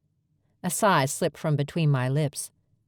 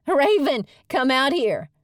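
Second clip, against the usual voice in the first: much higher and louder, calling across the cafe; 10 semitones higher, 4 dB louder than usual. Acted. Pixie calls Raven out from the kitchen, loud. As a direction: shout for her friend in the back.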